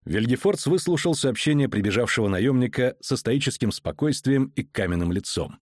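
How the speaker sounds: usual speaking voice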